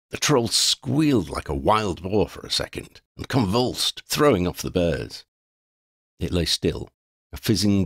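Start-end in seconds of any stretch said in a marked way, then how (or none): none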